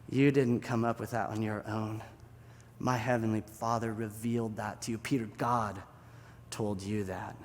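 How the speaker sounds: low, soft, unhurried phrases; gentle affirmation. Acted. Jesus credits the Father to Peter, gentle. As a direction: reassure him quietly.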